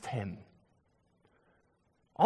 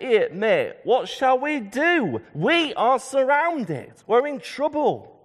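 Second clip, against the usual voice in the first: higher, drawn-out cry of alarm; panicked servant; 8 semitones higher, 4 dB louder, slower than usual. Acted. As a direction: act out a servant alarmed to his master. Servant panics at huge army.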